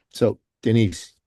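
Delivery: normal talking voice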